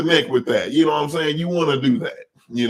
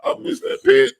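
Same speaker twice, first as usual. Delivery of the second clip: much higher, much louder wail, half crying; mock wailing cry; 5 dB louder, slower than usual. Telling a friend about the lost barbecue pit, mock wailing cry. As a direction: ham up a wailing sob over the lost pit. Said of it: "And I was like".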